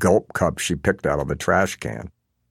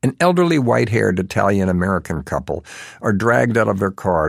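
ordinary delivery, nothing marked

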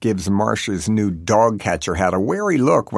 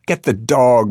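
louder, insistent yelled order; raised, emphatic call; mother's yell; 3 semitones higher, 6 dB louder than usual. A narrator's reading. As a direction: yell it out urgently. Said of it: "my mother yelled".

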